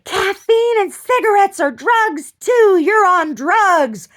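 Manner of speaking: high, loud, slow furious yell; imitating a screaming man. Strapped-down man in intake screaming at her, loud high slow yell. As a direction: bellow it out like a strapped-down man losing it. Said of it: "he's, like, screaming at me".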